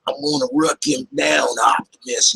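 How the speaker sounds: put-on hothead robot in a strong southern accent. Imitating a hothead robot talking to his leader, southern accent. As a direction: put on a thick southern twang. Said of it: "southern accent".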